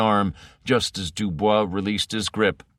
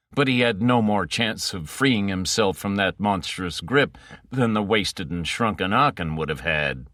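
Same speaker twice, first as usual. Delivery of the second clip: plain reading voice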